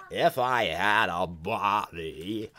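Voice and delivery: slow, drawn-out cartoon villain voice, building loud; Krang. Fan demonstrating the eighties cartoon villain, slow menacing voice. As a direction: stretch each word, swelling to a big villain finish. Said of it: "Krang sort of sounds like".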